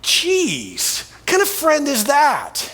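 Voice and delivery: mock a judgmental gossip in the crowd; scoffing onlooker. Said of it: "And they're like".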